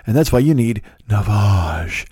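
slow, ominous mock sales tagline; creepy ad pitchman. Comedian closing the imitated Navage ad, slow creepy pitch. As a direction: linger creepily on the brand name. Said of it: "The way he says it is so creepy".

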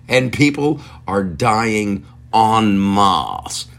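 usual speaking voice

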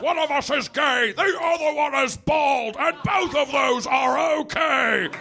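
high, slow, projecting British stage voice; British actors. Retold or imitated. Imitating Patrick Stewart and Ian McKellen, hammy stage. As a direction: boom it like a venerable old thespian. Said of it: "They're like".

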